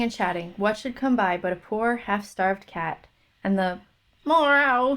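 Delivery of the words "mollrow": loud, high "mollrow" yowl; cat's yowl. A narrator's reading. Narrator voicing a half-starved cat's cry, loud yowl. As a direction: cry it out like a hungry cat. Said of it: "would make your heart ache".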